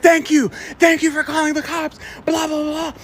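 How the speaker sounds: high, pointed imitation of her thanks; hammed-up high gratitude; mock outraged lady. Same lady thanking the caller.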